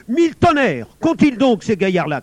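furious director bellowing, high and very loud; loud, outraged exclamation; angry shout. A narrator's reading. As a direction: thunder at the unruly boys. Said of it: "cria le directeur".

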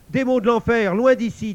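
high, loud command; banishing the demon. Acted. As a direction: drive the demon off with a cry. Elle chasse le démon. (ordre crié, aigu et fort)

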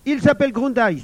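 high, very loud denunciation; urgent boyish outburst; crying out. A narrator's reading. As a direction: shout out the culprit's name. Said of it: "s'écria Émile".

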